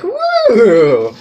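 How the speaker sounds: loud, high party woo; excited whoop. Acted. Narrator whoops after chugging Rockstar, loud and excited. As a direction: whoop like the drink just hit.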